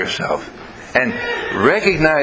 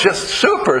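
ordinary delivery

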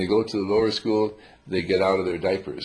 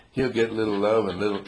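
usual speaking voice

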